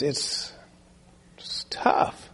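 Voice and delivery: hushed, breathless whisper; a moved, whispered aside. Real, not acted. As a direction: let it fall to a whisper. Preacher moved, drops to whisper.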